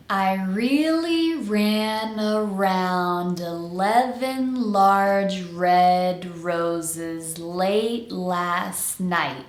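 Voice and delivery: very slow tongue twister, vowels stretched and every word connected. Shown as a demonstration. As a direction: draw it out slowly for learners to follow. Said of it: "say the entire tongue twister slowly".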